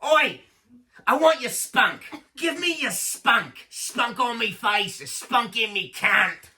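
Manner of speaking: high, loud, drawn-out British caricature; mock British accent. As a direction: yell crude demands in a hammed-up British accent. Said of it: "This, they do that".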